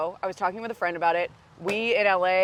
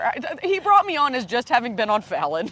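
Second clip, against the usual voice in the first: ordinary delivery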